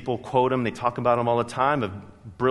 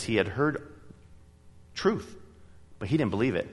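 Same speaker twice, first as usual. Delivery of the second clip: ordinary delivery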